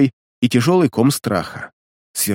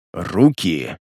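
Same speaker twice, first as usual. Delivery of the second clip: ghoul; 6 semitones lower than usual. A narrator's reading. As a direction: hiss the command coldly. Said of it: "ещё раз прошипел упырь". (тихое шипение упыря)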